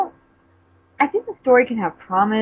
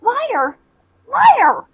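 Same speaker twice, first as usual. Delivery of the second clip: high, loud tearful yell; shouting; 4 dB louder than usual. Acted. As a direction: scream it at him. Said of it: "she shouted".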